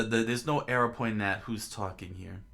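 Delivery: ordinary delivery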